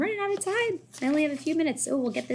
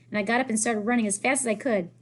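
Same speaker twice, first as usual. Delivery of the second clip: usual speaking voice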